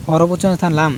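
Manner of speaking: usual speaking voice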